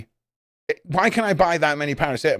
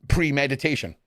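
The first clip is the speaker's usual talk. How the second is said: usual speaking voice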